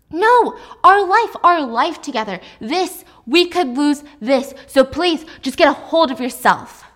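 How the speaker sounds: loud, rising anger, stressed our life; angry scolding. Acted. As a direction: scold her to pull herself together. Jack lectures Alice angrily.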